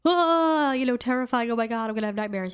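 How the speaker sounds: hammed-up frightened viewer, gabbling at the end; mock terror. Viewer scared by a kids' show spirit, mock terror.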